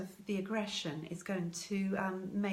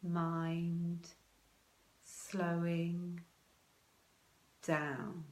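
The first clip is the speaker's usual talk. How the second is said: each word stretched with long gaps; unhurried soothing self-talk; slow mantra; much slower than usual. Shown as a demonstration. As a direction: draw out mind, slowing, down. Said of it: "something along the lines of".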